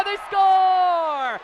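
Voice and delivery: high, loud drawn-out score; long goal yell. Real, not acted. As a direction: hold score long at full shout. Goal call, score held long.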